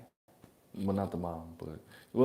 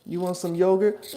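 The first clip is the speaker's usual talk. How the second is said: much higher, louder parent voice; parent offering; 8 semitones higher, 8 dB louder than usual. Pretend. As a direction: offer a toddler a snack. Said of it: "That's how my son talks".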